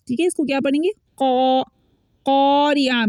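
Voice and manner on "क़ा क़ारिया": क़ारिया with thick q, "qawriya"; heavy correct qaf. Shown as a demonstration. Teacher answering what to read, heavy "qaw". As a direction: fill the mouth on क़ा. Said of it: "पढ़ना है".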